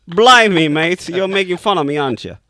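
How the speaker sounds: hammed-up British accent, high and loud; loud mock-British complaint, easing off at the end. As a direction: protest in a badly faked British accent. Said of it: "in your best British accent".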